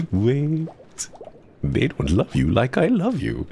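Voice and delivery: lightly voiced Heimerdinger, playful plea; Heimerdinger line. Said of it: "my favorite one is a Heimerdinger impression".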